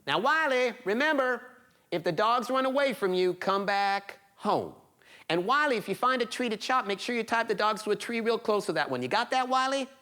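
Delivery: high-pitched, insistent motherly warning; nagging mother. Acted. Mother warning her son before the woods, insistent. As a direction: drill the safety rules in, pitched high. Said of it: "his mother said what she said every night".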